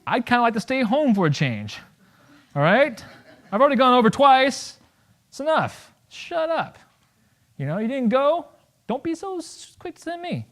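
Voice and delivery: high-pitched, fed-up protest; indignant, rising into a high squeak; exasperated retort. Acted. Exasperated retort to war cheerleader.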